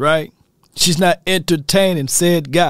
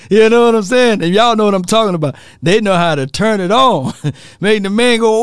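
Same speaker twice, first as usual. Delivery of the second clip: ordinary delivery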